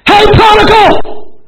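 shouted high-pitched sermon climax; preaching at full force. Acted.

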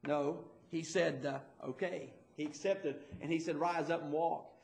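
quiet, intimate preaching; hushed storytelling. Acted. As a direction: draw them in with a soft, low voice. Preacher drops to hushed retelling.

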